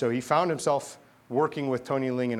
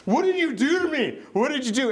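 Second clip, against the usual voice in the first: much higher, louder and stunned; bewildered cry; 11 semitones higher, 4 dB louder than usual. Retold or imitated. Punk leader stunned after prayer, high frightened cry. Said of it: "the guy's like".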